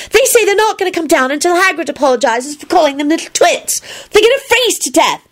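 high-pitched tattling; tattling girl. Acted. Ginny reports the boys in the tree, tattling.

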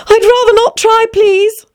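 put-on high-pitched, girlish refusal; polite Alice.

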